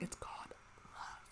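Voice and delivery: conspiratorial stage whisper; hushed, knowing aside; teasing whisper. Pretend. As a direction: whisper it like a secret to the listener. Teasing the oblivious heroine.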